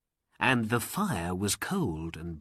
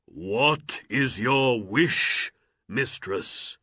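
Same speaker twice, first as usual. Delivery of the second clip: genie voice; slower than usual. Acted. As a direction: speak like a servant spirit. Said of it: "cried the genie".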